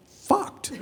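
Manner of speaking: word jumps up into a squeak; squeaky high. Real, not acted.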